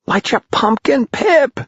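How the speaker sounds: put-on very high boy's shriek, rising; frantic shriek. A narrator's reading. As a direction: shriek desperately to a friend in the dark. Tom shrieks to fallen friend again.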